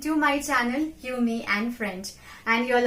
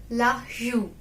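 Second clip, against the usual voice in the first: normal talking voice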